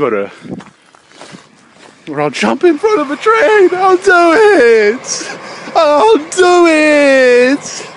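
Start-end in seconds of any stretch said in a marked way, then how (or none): 2.1-7.9 high, wailing scream, hugely drawn out; over-the-top screamed countdown, stretched and shrill; mock desperate threat. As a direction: shriek it like a hammed-up ultimatum. Mock threat to jump for subscribers.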